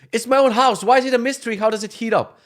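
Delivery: loud, high-pitched indignation; exasperated protest. Retold or imitated. Homeowner to heating service, loudly exasperated. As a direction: act out baffled, fed-up complaining.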